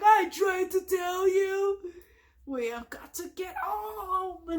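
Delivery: high slow desperate cry; strained shout. Retold or imitated. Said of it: "he's like".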